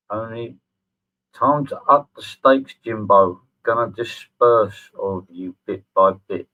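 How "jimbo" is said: ordinary talk, nothing marked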